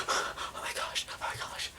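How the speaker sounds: mock panicked whispering; frightened whisper. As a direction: whisper like a girl hiding from danger. Hiding girl in retold scene.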